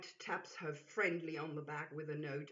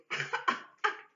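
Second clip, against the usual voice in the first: howling laugh; 13 semitones higher, 6 dB louder than usual. Acted. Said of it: "U S howling".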